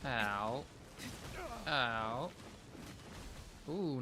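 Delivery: ordinary talk, nothing marked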